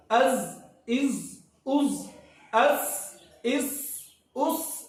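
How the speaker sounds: از and اس drawn out, the final letter fully pressed out; full sound. Shown as a demonstration. Teacher models full articulation of از and اس. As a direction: squeeze every bit of sound from از, اس. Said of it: "پوری پوری آواز یہاں پہ نچوڑ".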